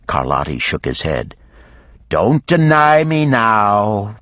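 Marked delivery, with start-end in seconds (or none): 2.1-4.2 slow, higher dragon voice. A narrator's reading. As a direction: drag it out, a menacing dragon. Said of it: "the dragon went on".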